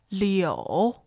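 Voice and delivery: Mandarin third tone Liu. Shown as a demonstration. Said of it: "Here are the four tones".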